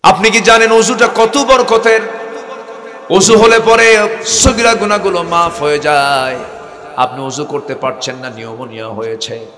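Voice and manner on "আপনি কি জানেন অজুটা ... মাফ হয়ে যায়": loud, high, slow sermon cry; fiery preaching. Acted. Preacher urging the congregation, impassioned shouting. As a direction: thunder out God's promise.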